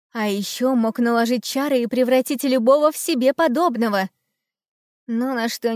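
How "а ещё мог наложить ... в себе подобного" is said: usual speaking voice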